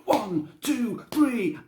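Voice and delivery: high, emphatic count-out; referee's count. Retold or imitated. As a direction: imitate a ref calling the pin. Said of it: "the ref just goes".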